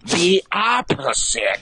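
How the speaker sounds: mock Chris Rock voice that says everything three times; Chris Rock impression. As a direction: hammer every line like a stand-up sermon, with a laugh. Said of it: "That's how he would say it".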